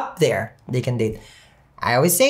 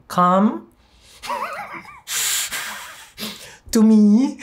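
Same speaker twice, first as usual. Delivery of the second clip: slowed down; 4 semitones higher, much slower than usual. Shown as a demonstration. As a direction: COME and ME drawn apart, breaking into giggles. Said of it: "don't ever slow it down".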